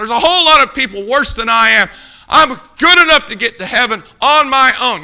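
acted indignant protest, loud and high; defiant objection. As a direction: argue back at the preacher. Voicing a defiant objector to preacher.

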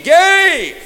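gave shouted high and loud; preacher booming the single word gave; stress on gave. Shown as a demonstration. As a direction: hit gave with a big loud high call. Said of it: "just circle that word".

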